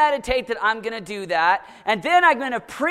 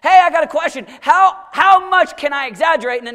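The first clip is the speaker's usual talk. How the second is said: eager voice of someone asking how far they can go; mock questioner; 5 semitones higher, 7 dB louder than usual. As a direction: play the bargaining churchgoer. Preacher voicing a questioner.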